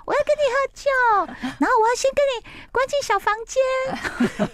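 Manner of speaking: very high, teasing squeal; flirty fangirl. Retold or imitated. [name] teasing the husband over the phone, very high flirty squeal. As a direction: flirt outrageously, all in character. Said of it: "他就非常的入戏".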